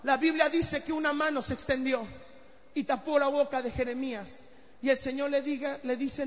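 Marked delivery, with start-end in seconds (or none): none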